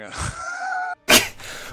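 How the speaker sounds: loud, very high burst of laughter; high-pitched laugh. Real, not acted. Game master cracks up at the cheeky player, high laugh. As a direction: squeal with laughter.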